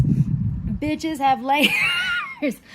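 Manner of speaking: laughing loudly through a slow, drawn-out line; laughing punchline. Real, not acted. To her viewers, a loud line broken by laughter. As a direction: crack up mid-line while insisting on it.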